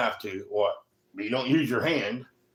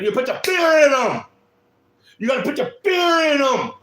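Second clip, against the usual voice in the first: yelling; 10 semitones higher, 6 dB louder than usual. Shown as a demonstration. Telling parents how to teach kids about danger, yelling. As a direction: belt it out to scare them straight. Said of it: "I know I was yelling".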